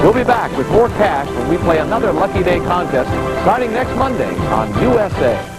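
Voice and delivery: upbeat promo read over music; announcer voice. Acted. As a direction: sell the contest like a TV promo.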